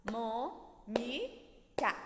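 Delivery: "Mônica" broken slowly into MÔ, NI, CA; three separate beats for "Mônica", a gap after each; syllable by syllable. Shown as a demonstration. Teacher asks how many syllables, word split in beats. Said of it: "Mônica tem quantas sílabas?".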